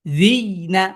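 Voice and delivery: জি caught on a y before না; জি-y, then না; sakin on ya. Shown as a demonstration. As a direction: press a y at the end of জি, then release না. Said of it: "তখন উচ্চারিত কি হবে".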